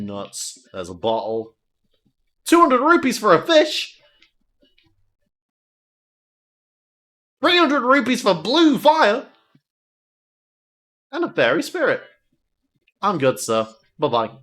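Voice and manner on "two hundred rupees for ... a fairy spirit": higher, louder price calls; hawking wares; pushy seller. Acted.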